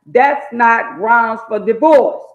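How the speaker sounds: stern preached correction; emphatic preaching. Real, not acted. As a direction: lay down the rule hard. Preaching correction, loud slow.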